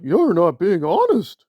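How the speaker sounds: hammed-up indignant pestering; mocking Nora. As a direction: imitate a nagging critic with exaggerated demands. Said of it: "And she's like".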